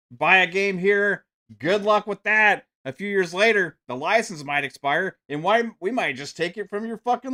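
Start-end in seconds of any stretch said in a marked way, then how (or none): 1.6-2.7 higher, louder sarcastic jab; sarcastic refrain. Real, not acted. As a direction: toss it off with biting sarcasm. Sarcastic refrain against Sony.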